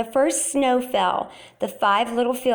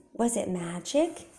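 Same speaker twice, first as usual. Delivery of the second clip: usual speaking voice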